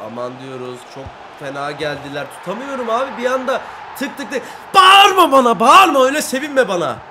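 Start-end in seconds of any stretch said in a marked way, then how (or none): 2.4-4.4 frantic shout. Real, not acted. Conceding pressure, shouting. 4.7-7.0 high, very loud angry yell; furious shout. Real, not acted. Streamer yelling at the celebrating opponent, furious. Rival celebrates, he yells back.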